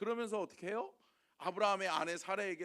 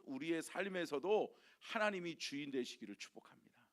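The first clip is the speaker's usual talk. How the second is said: ordinary talk, nothing marked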